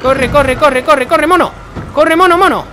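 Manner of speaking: shouted hurry-up climbing very high; repeated high, loud cries building to a squeal; frantic urging. Real, not acted. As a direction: urge them on in rising panic. Frantically urging game character.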